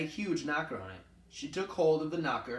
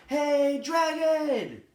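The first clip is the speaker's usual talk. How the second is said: much higher, much louder full shout, Hey, dragon!; shouted as loud as possible; 10 dB louder, much slower than usual. Acted. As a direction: yell right into the sleeping dragon's ear. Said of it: "She shouted as loud as she could".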